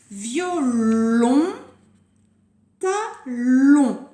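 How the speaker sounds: Violon and talon with a loud, held LON; syllable by syllable. Shown as a demonstration. Teacher launching the first game, syllable by syllable. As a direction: split off and stretch the last syllable of Violon, talon.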